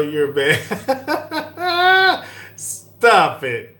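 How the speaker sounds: high giggling fit, then stop it; laughing. Real, not acted. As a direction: laugh helplessly at the joke. Cracking up at viewer joke.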